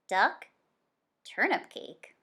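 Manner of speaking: ordinary delivery, nothing marked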